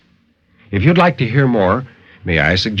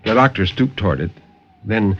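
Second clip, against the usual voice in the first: dramatic story narration. A narrator's reading. Narrator reading a horror tale to radio listeners, dramatic and intense. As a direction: tell the horror tale with rising urgency, then settle.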